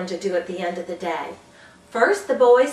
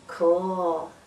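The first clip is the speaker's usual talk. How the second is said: lower, laid-back cool; cool cheer; 4 semitones lower than usual. Shown as a demonstration. Teacher demos cool cheer for older kids: laid-back. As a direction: drop it low and easy. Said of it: "Older kids like this one".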